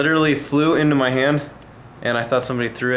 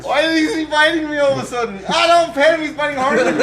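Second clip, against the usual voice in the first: laughing; 11 semitones higher than usual. Real, not acted. Being bitten, laughing.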